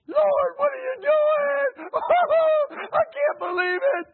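high, loud wailing cry; distraught cry to the Lord after being fired; crying out to God. Retold or imitated. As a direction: sob and shout at heaven in disbelief. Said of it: "I cried".

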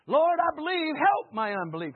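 loud plea, starting high; crying out for help. Acted. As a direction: shout the prayer to heaven. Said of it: "cry out for help".

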